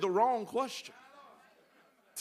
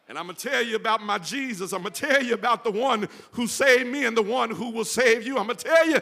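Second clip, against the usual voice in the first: fast, building to a louder, higher shout; preaching at full force; 6 semitones higher, 7 dB louder than usual. Real, not acted. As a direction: charge ahead as if through the open door. Preacher voicing Peter, building fast.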